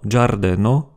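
"giardeno" slowly, starting dja; "giardeno" drawn out as djar-de-no; DJ sound. Shown as a demonstration. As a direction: open "giardeno" with a dj.

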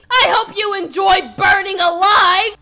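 loud, gloating send-off; vengeful shout. Acted. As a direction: shout it like a final act of revenge. Acted revenge on the boyfriend.

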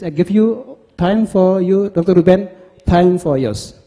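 normal talking voice

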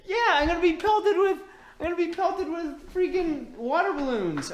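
high, laughing dread; nervous laughter. Real, not acted. Dreading the balloon pelting.

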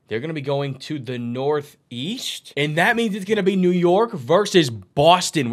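ordinary talk

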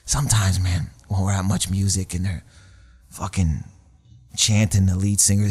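a hint of a sloppy drunk griping; drunk rant. Drunk singer complaining to the comic about fans, low. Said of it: "He goes".

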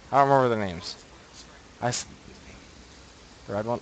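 ordinary talk

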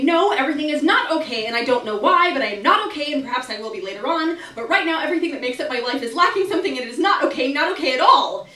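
screaming outburst. A narrator's reading. Woman screaming inside at her landlord, loud outburst. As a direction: let it all burst out at full volume. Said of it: "wants to scream and say".

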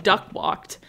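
ordinary talk, nothing marked

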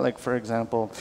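ordinary talk, nothing marked

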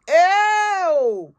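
high, loud, drawn-out "ew"; shocked cry. Acted. Shocked reaction to reported news.

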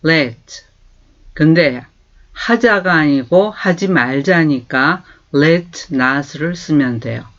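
normal talking voice

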